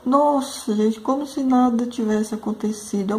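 normal talking voice

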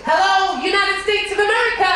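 high, bright hail to the crowd; big hello. Shown as a demonstration. Singer greeting American passengers, high and excited. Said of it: "let's have a quick".

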